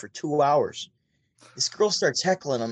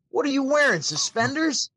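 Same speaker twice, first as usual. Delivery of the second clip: high, teasing mock roast; high teasing jab at the guy in suspenders; mock comedian roast; 11 semitones higher than usual. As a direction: needle him like a comic on stage. Comedian roasting audience member.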